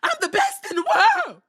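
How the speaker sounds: high, loud hammed-up boast; mock big shout. Retelling the wrestler's closing line, shouted high and loud. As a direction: belt it out like a wrestler's brag. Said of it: "he said".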